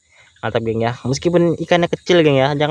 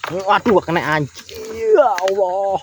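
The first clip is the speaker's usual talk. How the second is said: frustrated groan; 6 semitones higher, slower than usual. Real, not acted. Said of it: "Saya hampir aja misuh".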